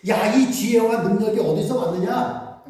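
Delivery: high, loud astonished cry; amazed townsfolk. Acted. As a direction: gasp it out in wonder. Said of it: "사람들이 보고 놀라". (높고 크게 놀라 외치듯)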